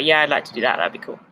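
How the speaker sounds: half-hearted, sinking at the end; unenthusiastic. Real, not acted. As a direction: agree without much enthusiasm. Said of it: "That didn't sound enthusiastic".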